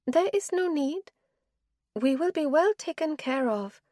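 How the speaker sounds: wife's voice. Acted. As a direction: she refuses him, tired and final. Wife's voice again.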